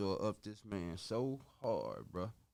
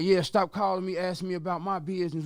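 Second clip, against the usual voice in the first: louder, brushing him off hard; retold tough and mad; 5 semitones higher, 8 dB louder than usual. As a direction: Debo snapping at the caller to back off. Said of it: "got real tough and mad".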